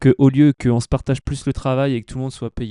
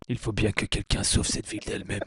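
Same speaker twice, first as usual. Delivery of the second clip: lower, softer dark hero voice; brooding vigilante; 6 semitones lower, 10 dB quieter than usual. Pretend. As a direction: brood like a grim avenger. Vigilante monologue, low and soft.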